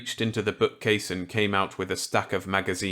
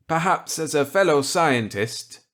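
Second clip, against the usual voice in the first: higher, louder voice for kindly Millet; Millet's voice; 8 semitones higher, 6 dB louder than usual. Acted. Millet offering Walker a gambit, kindly. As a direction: play the genial old scientist warmly.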